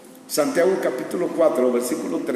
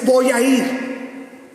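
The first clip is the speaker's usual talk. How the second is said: high, drawn-out boast; hammed-up confidence; mock confident declaration; 7 semitones higher, 3 dB louder, much slower than usual. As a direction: stretch it out like a sure-of-himself braggart. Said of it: "cuánta gente dice".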